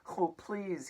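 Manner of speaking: high, pleading plea; begging. Acted. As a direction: beg the aunts to let him out. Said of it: "he had begged".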